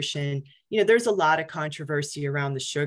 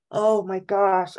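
drawn-out, louder mortified groan of words; humiliated; 4 semitones higher, 6 dB louder, slower than usual. Retold or imitated. Patient retelling a hospital accident, humiliated. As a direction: retell a slow, embarrassed exclamation. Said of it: "I was, like, humiliated".

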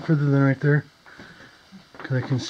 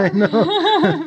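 laughing it out high; real laugh, blurting through the giggle; giggling agreement; 12 semitones higher, 4 dB louder than usual. Real, not acted. Shared laugh at scared question.

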